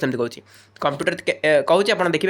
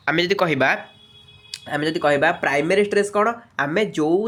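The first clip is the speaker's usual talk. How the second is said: usual speaking voice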